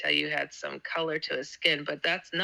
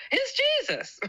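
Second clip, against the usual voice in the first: a much higher, excited mock cry of the name; retold inner scream. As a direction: let it burst out as a joyful exclamation. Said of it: "every cell in my body screamed".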